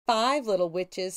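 plain reading voice

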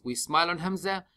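usual speaking voice

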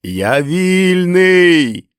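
high, very loud, long-drawn cry; stretched, soaring shout of freedom; triumphant shout. Acted. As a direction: yell it out in jubilation. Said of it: "закричав він".

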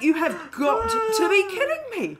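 outraged disbelief, laughing; loud shocked cry; incredulous cry. Retold or imitated. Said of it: "I was just like".

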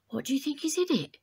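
quick excited whisper; curious whisper. Acted. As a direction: whisper it fast, itching to peek. Pandora curious about box.

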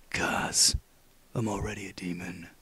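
very low, rough whispery growl; Snake voice. Shown as a demonstration. Gamer showing how snake voice should be, low growl. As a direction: growl it very low, close to the mic. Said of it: "It's much more like".

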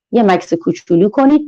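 ordinary delivery

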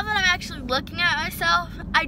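talking with lip pulled down; speaking around fingertip. Real, not acted. Seen 0: Finger presses lower lip, showing braces, from 0.4 to 2.2 s.

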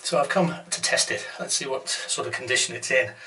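ordinary talk

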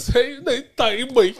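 high, loud, giggly Bajan accent; Bajan accent demo. Shown as a demonstration. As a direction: toss out a playful Bajan catchphrase. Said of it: "Yeah, like that. Do it like that".

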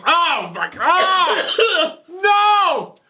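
high pained cries from brain freeze. Real, not acted. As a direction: squeal through the cold headache. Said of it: "I got a brain freeze".

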